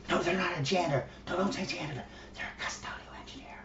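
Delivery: hushed, fading into a whisper; mock whisper. Mocking the polite crowd, whispering the correction. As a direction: hiss it like a scandalous secret. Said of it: "The one who's whispering janitor?".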